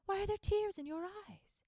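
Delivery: mother asking her tearful son; gently asking mother. Acted. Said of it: "his mother asked".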